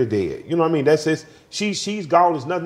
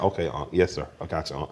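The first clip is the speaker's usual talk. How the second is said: placating reply; 6 semitones lower, 6 dB quieter than usual. Retold or imitated. Retells humouring the uncle's advice.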